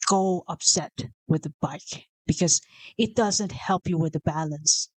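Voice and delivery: ordinary talk, nothing marked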